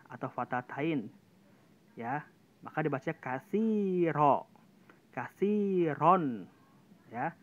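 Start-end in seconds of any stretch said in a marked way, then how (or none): 5.1-6.5 kasiron drawn out very slowly with a thick, heavy ro. Shown as a demonstration. Teacher reciting a heavy ro example for the class, slow. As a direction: stretch out kasiron, darkening the ro. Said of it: "tebal banget tuh".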